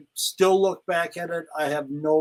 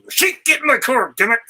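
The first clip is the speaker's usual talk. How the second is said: higher, louder, fed-up command; exasperated order; 7 semitones higher, 6 dB louder than usual. Retold or imitated. Manager ordering the Iron Sheik into his car, loud and exasperated. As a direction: snap at him to get in the car now.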